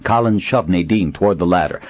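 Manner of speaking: usual speaking voice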